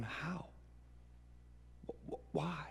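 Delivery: tiny hushed disbelief, stammering; stunned whisper. Acted. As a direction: whisper it, barely able to speak. Stunned coworker asks why.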